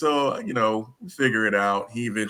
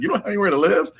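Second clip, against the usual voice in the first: high, rising, amused disbelief; mock the ladies' shocked surprise; surprised retold question; 12 semitones higher, 5 dB louder, much faster than usual. Office ladies voiced, surprised.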